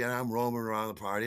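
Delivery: ordinary delivery, nothing marked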